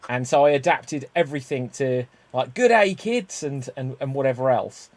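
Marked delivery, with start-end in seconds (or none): 2.5-3.4 high, loud Australian greeting; Australian kids' show hello; Australian accent. Retold or imitated. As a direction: call out to children in a broad Australian accent. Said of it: "with an Australian accent".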